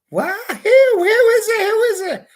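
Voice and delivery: shrill-high and loud, over-eager; excited girlfriend. Retold or imitated. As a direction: squeal it like she can't wait to know. Girlfriend excitedly asking who.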